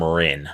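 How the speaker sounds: "Rin" with a short i, as in win; mispronounced name. Shown as a demonstration. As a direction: say "Rin" as one short-vowel syllable. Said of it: "need to learn how to pronounce".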